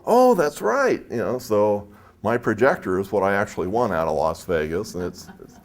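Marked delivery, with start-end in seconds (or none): 0.1-1.0 higher, bright dawning realization; light up as it comes back; much higher sudden recall; remembering. Retold or imitated. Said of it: "And I go".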